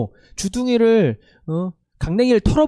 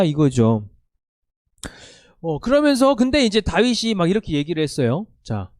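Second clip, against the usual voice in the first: normal talking voice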